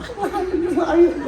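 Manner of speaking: high-pitched protest; mock disbelief. Grown student disputing the little girl's claim, high mock protest.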